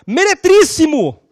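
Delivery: slow, loud, high and pompous Meretríssimo; grand address. Retold or imitated. Intern proudly greeting judge, loud slow pompous call. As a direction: proclaim it solemnly like an intern.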